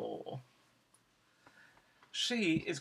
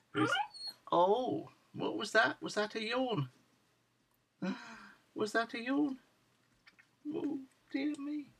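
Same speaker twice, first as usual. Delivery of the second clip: cooing pet talk; 9 semitones higher than usual. Real, not acted. Owner catches puppy yawning, cooing high in surprise. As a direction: coo in wonder at a tiny puppy, pitched right up.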